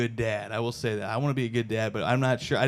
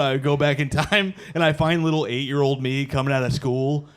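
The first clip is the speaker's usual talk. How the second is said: ordinary talk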